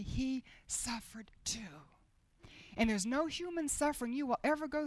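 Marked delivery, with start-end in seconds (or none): 0.0-1.6 soft slow whisper. Acted. Preacher stressing Christ suffered too, whispered. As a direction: let it drop to a hush.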